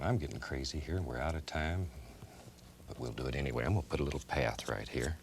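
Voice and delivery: ordinary talk, nothing marked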